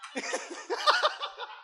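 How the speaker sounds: high giggle after the punchline; high laugh. Real, not acted.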